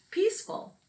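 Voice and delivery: plain reading voice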